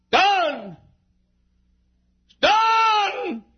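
high, long-held preacher's cry; triumphant shout. Acted. Preacher voicing Jesus on the cross, triumphant shout. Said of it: "he shouted".

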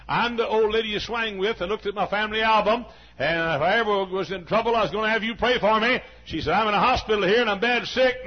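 loud, high, urgent voice on the phone; gruff big lady on the phone. Retold or imitated. Sick old lady on the phone urges the preacher, loud and high. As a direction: call the preacher loudly from the hospital bed. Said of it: "A gruff voice, big lady, gruff voice".

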